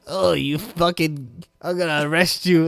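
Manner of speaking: mock character threat. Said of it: "she's like".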